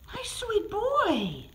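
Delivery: stretched, high sing-song for a pet; high slow coo. Real, not acted. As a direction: coo it slowly and high to the cat. Cooing to cat, higher take.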